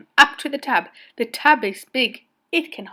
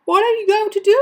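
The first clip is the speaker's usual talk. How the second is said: high, loud, eager asking; anxious question; 10 semitones higher, 6 dB louder, faster than usual. A narrator's reading. Boy asking Mister Carp his plan, high anxious voice. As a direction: ask with urgent worry.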